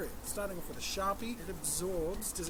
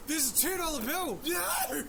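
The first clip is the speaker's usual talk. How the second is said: higher, louder shout of dismay; real alarmed shout; shocked outburst; 12 semitones higher, 7 dB louder than usual. Real, not acted. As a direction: cry out at the missing bill. Discovers missing two dollar bill.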